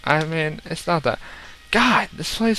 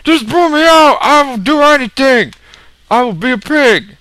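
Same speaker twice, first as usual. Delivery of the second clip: high, shouted begging for rescue; panicked pig's plea; 14 semitones higher, 8 dB louder than usual. Pretend. Burning pig begging the player for rescue, mock-panicked shouting. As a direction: scream pleas in mock panic. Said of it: "He's like".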